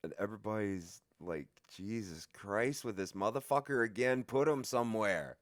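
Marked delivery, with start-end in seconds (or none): 1.7-5.4 louder, fed-up groan at the nuisance; exasperated complaint. Retold or imitated. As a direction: complain like he's done it again. Cellmates fed up with him.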